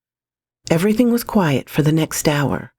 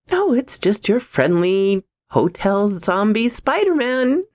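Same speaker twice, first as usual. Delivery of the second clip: sarcastic; 6 semitones higher than usual. A narrator's reading. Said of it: "I told myself as I glared".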